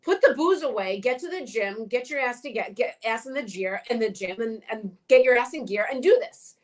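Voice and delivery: insistent, no-nonsense coaching; harder tough love. Shown as a demonstration. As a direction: give firm marching orders, one after another. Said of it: "I might be a little harder on you".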